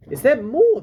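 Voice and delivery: high, loud startled question; surprised. A narrator's reading. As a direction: blurt it out in alarm.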